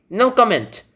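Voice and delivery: high-pitched stonewalling; firm "no comment". Acted. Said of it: "That's a pretty good no comment".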